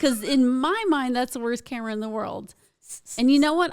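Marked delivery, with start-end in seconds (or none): 3.2-3.7 loud, high rhetorical lead-in; emphatic. Real, not acted. Guest talks herself into the gig, loud and emphatic. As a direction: punch it like a big reveal.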